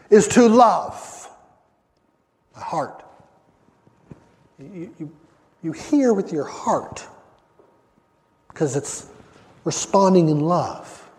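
loud, driving sermon voice with repeated starts; impassioned preaching. Acted. Preacher pressing the congregation on what hearing means, impassioned. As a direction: press the point home.

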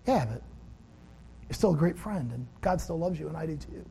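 lightly voiced tender friend; gentle reassurance. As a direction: comfort him quietly.

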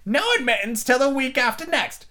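high, curt creature voice; beaked creature turning Alice away, curt; creature at the door. A narrator's reading. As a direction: bark it out and slam the door. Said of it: "a creature with a long beak".

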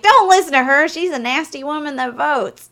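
hosting banter. Retold or imitated. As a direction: tease her from the stage.

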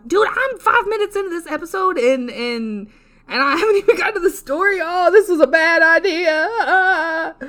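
loud, high, laughing lament; wailing dismay. Real, not acted. Mock despair at stalled episode.

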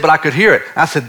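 usual speaking voice

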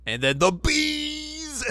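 hammed-up alarm; mock dramatic cry. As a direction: stretch out the bees dramatically. Dramatic exclamation.

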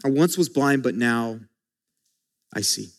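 quiet, with a pause before I see; softly repeated. Shown as a demonstration. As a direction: drop to near silence at the end. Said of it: "the most powerful words in Scripture".

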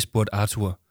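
plain reading voice

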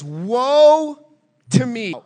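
Woe stretched out high and loud; a prophet wailing woe to me; anguished cry. Acted. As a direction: cry out in despair.

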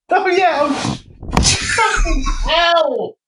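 high-pitched cry through laughter; laughing outburst. Real, not acted. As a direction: shriek it helplessly while laughing. Helpless reaction to the laughing joke.

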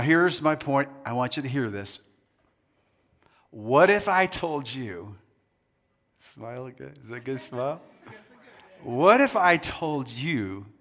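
normal talking voice

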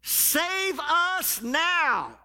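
loud, slow, drawn-out cry; shouted plea. Retold or imitated. Preacher voicing the crowd begging Jesus, slow loud shout. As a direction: stretch each word as a pleading shout.

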